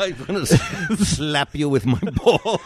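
can barely get the threat out for laughing; laughing through the quote. Retold or imitated. Repeating scammer's threat, laughing.

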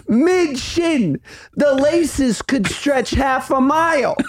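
high mock-outraged rant about boots; incredulous rant. Real, not acted. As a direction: rant in comic disbelief. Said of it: "ranting about combat boots".

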